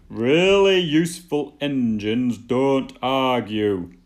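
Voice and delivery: loud, slow stern shout. Acted. Fat Controller scolding Thomas, slow loud shout. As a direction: lay down the law loudly. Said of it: "shouted the Fat Controller".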